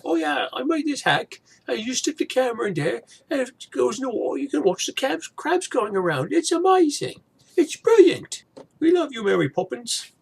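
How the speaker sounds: gushing, high Cockney impression of a vlogger, building to a loud finish; bad Cockney accent. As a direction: overdo a terrible East End Cockney accent. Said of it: "that's a really bad, uh, East Ender Cockney accent".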